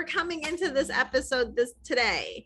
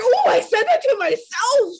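shocked realization; 9 semitones higher, 8 dB louder than usual. Retold or imitated. Realizing she insulted herself.

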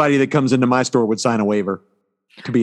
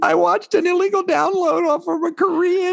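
put-on high, slow sheepish kid voice; imitating daughter; slower than usual. Father imitates daughter admitting illegal download, high and sheepish. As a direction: play the guilty daughter owning up.

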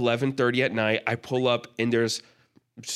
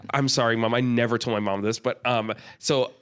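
ordinary talk

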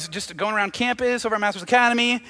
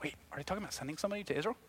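very soft, rapid aside; eavesdropping kid; 2 semitones lower, 17 dB quieter, much faster than usual. Acted. Isaiah listens in on a grown-up conversation, hushed and quick. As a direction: murmur it fast, as if overhearing. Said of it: "trying to listen in, like a kid".

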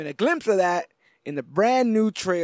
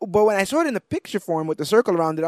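ordinary talk

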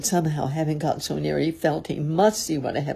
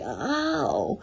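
high, drawn-out "ow"; yelp of pain; 7 semitones higher than usual. A narrator's reading. Pooh bumping about in the jar, high yelp of pain. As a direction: let out a long pained howl. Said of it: "and mostly".

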